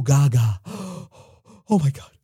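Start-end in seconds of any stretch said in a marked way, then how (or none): none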